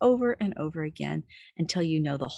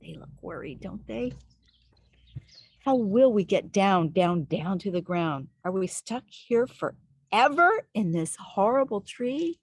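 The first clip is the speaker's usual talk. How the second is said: put-on reading to children; 5 semitones higher than usual. Stranded tree dwellers wailing to children listening, mock dismay. Stuck characters lament.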